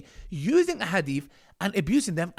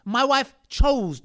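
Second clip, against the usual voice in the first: slow, louder and higher, each word hit; emphatic stress; 7 semitones higher, 6 dB louder, much slower than usual. Real, not acted. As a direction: hammer home that it was her choice. Angry rebuttal, stressing the wife's choice.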